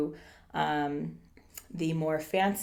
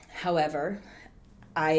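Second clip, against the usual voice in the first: normal talking voice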